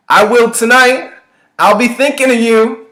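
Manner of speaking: high, loud taunt; jeering shout. A narrator's reading. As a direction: yell a leering comeback from the truck. Catcaller jeers back from truck.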